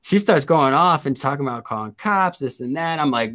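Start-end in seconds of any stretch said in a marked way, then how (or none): none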